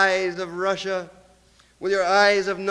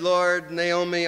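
ordinary talk

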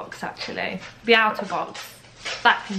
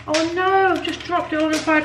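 high, louder dismay, quick; oops; 9 semitones higher, 4 dB louder than usual. Real, not acted. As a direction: caught off guard by the spill. Drops food bag while cooking.

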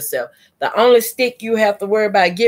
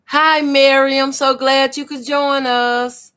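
high, bright welcome; excited greeting; 7 semitones higher than usual. Real, not acted. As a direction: greet her high and cheerful. Greeting viewer joining live.

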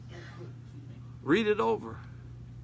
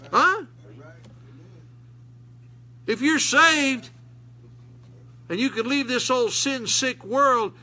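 raised, fervent preacher voice; preaching; 6 semitones higher, 5 dB louder than usual. Acted. Preacher pressing the church, fervent. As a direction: preach it out loud to the congregation.

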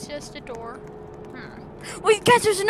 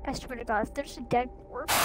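ordinary talk

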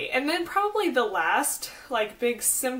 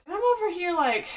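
ordinary delivery

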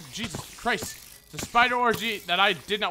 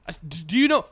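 ordinary talk

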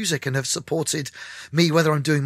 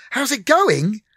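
higher, louder echoed question; excited echo; 10 semitones higher, 5 dB louder, slower than usual. Retold or imitated. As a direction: throw the question back, thrilled. Speaker echoes it back, higher.

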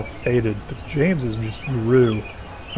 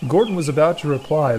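ordinary delivery, nothing marked

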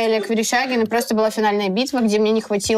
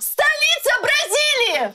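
high, slow urgent call; high, slow game hint; drawn-out clue shout; 13 semitones higher, slower than usual. Real, not acted. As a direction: stretch the clue for your teammate.

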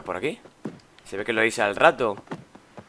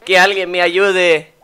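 slow, loud, raised pleading cry; Luigi pleading; 5 semitones higher, 5 dB louder, slower than usual. Acted. Trapped between dimensions, calling out slow and loud. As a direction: beg slowly for someone to hear you. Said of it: "Otra vez su voz".

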